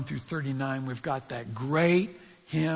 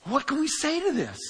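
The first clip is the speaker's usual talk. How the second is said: higher, faster, eager question; Paul's rhetorical question; 11 semitones higher, 4 dB louder, faster than usual. Retold or imitated. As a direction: ask it as if Paul is bursting to answer. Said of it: "Paul starts out by saying".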